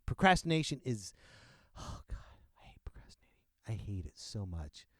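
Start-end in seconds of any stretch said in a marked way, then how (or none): 1.8-3.3 fast breathless whisper to self; whispered aside. Real, not acted. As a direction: hiss it under your breath, quickly.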